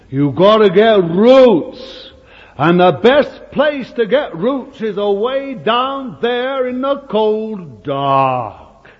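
preacher urging the congregation to grow roots; preaching. Real, not acted. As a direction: drive it home from the pulpit. Preaching at full force.

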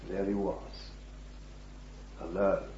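ordinary talk, nothing marked

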